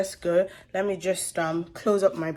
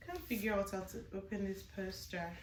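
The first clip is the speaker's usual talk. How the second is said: very soft hushed voice; 12 dB quieter than usual. Real, not acted. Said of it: "I can't shout".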